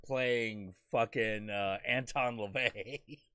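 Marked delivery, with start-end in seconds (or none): none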